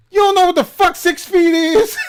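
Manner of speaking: put-on high, loud furious yell at an old lady; angry shout. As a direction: blast her with outrage. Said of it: "I was so fucking pissed".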